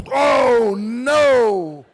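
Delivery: big, drawn-out wail of dread; loud dismay. Acted. Big man squeezed into centre seat, loud dismayed wail. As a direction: bellow it long and loud in comic horror.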